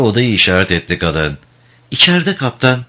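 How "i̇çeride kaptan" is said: woman's voice. Acted. Innkeeper woman answers captain.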